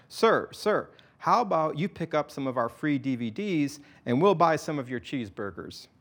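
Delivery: ordinary delivery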